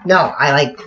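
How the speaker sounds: ordinary delivery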